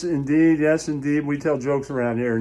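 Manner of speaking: ordinary talk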